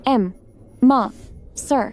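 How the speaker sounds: halting broken syllables before the word; stammering. Acted. Girl tries to address the man, stammering. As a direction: struggle to get the word out, with pauses. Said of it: "छोटी हकलाने वाली".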